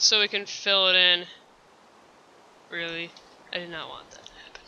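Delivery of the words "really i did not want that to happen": unvoiced hushed whisper; fast dismayed whisper. Real, not acted. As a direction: breathe it out in a disappointed whisper. Quiet disappointment, game going wrong.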